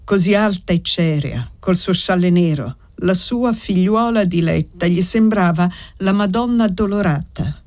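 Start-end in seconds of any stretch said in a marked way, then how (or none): none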